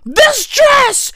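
very high, slow delighted gasp; hammed-up high swoon of delight; gushing. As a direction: swoon over it in a high drawn-out squeal. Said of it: "I was like".

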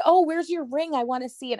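imitating a friend's eager high voice; excited ask. Friends asking to see the ring, eager and high. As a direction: pitch up high like a thrilled friend.

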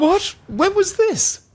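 very high-pitched feigned astonishment; mock surprise. Co-host feigns ignorance of meeting the guest, high-pitched surprise. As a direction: squeak it out as if it's news to you.

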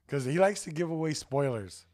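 ordinary delivery

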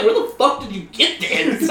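high, laughing demand; comic outraged disbelief; mock appalled dad. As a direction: imitate a dad's horrified question, amused. Acting the shocked dad.

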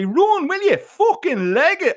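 high, loud desperate roar. Acted. Boy roaring at his frozen friend to flee, loud high roar. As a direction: bellow at him to run. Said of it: "I roared at him".

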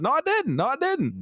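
high squeaky denial, repeated; mock protest. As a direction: squeal the denial like a wronged kid. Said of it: "I said".

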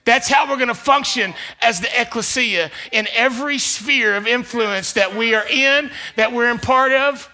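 loud, high preaching build; full-force preaching. Acted. Pastor preaching loudly to the congregation. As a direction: preach it out to the church.

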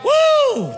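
high exultant woo shout; joyful whoop. Real, not acted. Preacher whoops to the congregation about the throne, jubilant cry.

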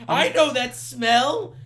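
high, very slow, loud mock horror. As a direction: draw it out like a scandalised diva. Mock-raging [name] at hamburger fan.

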